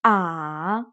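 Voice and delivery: "Ah" dipping low then up; third tone. Shown as a demonstration. Teacher drills the dipping third tone. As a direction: dip "Ah" low.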